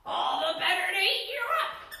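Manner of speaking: loud, menacing wolf line; spring the trap on her; a loud, hungry wolf voice; wolf pouncing. Acted. Wolf reveals himself.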